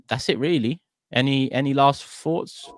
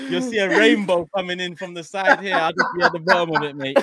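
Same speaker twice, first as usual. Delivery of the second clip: much higher, laughing hard; giddy laughing with a higher voice; 13 semitones higher, 7 dB louder than usual. Real, not acted. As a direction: squeal it out between laughs. Laughing about Irish persona.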